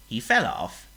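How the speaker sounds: ordinary delivery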